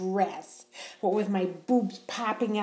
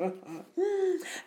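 high giggle; 10 semitones higher, 4 dB quieter than usual. Acted. Embarrassed laughter after a joke.

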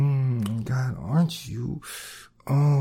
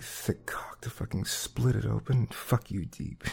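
whisper; 6 dB quieter than usual. Acted.

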